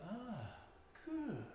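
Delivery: put-on light Dutch accent, "good" lifted high; pleased Dutch caller. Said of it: "Is that your Dutch accent".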